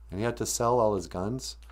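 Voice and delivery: ordinary delivery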